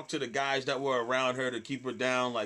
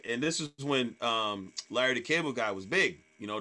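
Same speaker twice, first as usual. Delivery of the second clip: ordinary delivery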